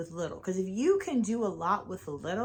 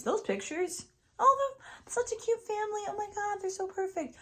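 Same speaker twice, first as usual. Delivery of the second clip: much higher, sing-song fawning over a photo; mock gushing; 13 semitones higher than usual. Mimicking people admiring a perfect family online, gushing. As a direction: gush like a fan scrolling Facebook.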